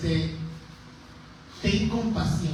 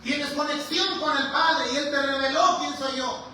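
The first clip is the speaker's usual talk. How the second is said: high, exclaimed "Oh, Pedro, wow"; hammed-up delighted teacher, much higher; mock over-the-top admiration; gushing praise; 5 semitones higher than usual. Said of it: "Bipolar el maestro. Primero le dice".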